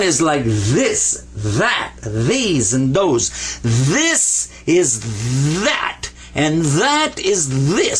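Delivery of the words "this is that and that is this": "this" and "that" very slow with voiced th; heavy TH demo. Shown as a demonstration. Teacher models the heavy TH sound. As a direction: stretch the heavy th in "this" and "that". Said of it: "give it a heavy T H sound".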